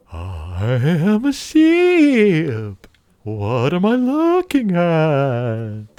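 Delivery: very slow, bleating ghost sheep; spooky sheep voice. Acted. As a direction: moan it out like a cartoon sheep ghost. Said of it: "the voice that someone would do for a sheep".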